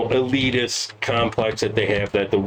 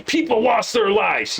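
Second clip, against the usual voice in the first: higher-pitched mock outcry; mock outrage; 8 semitones higher than usual. Host parroting the January sixth narrative, mock outrage. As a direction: parrot the alarmed line in a raised voice.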